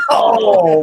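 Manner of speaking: loud laughing cry; laughing shout. Real, not acted. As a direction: howl with laughter. Reaction to takedown.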